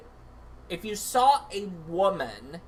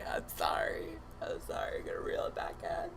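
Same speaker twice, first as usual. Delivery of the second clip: mock composing whisper; 8 dB quieter than usual. Pretend anchor composing himself.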